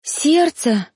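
high, alarmed exclamation; girl spotting his hand on his heart, alarmed and high; alarmed gasp. Acted. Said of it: "встревоженно ахнула девушка".

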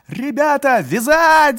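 very high, loud rallying yell; shouting. A narrator's reading. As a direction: scream to seize them. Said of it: "закричал тот же голос".

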